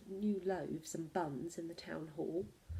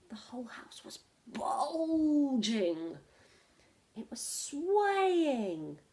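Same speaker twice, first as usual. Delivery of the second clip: drawn out, higher, spooky slow; slow and dramatic; 4 semitones higher, much slower than usual. A narrator's reading. Teacher reading to children, slow dramatic suspense. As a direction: let each word sway slowly.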